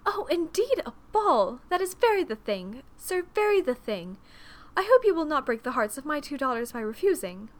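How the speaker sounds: loud, high matronly gushing; overexcited mother, voice leaping up; gushing silly mother. A narrator's reading. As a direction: effuse loudly over the idea. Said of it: "her mother crowned this silliness with".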